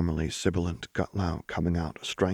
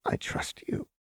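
soft, breath-only whisper; 5 dB quieter than usual. A narrator's reading. Jinx telling Lux she trusts her, whispering. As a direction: whisper it as a fragile confession. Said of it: "Jinx whispered".

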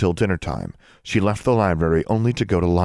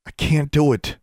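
heavily; 8 semitones higher than usual. A narrator's reading. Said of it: "she said heavily".

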